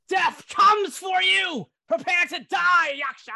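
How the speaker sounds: high, full-throated battle scream; screamed battle cry. Acted. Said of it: "She makes out this loud battle cry, and she screams out".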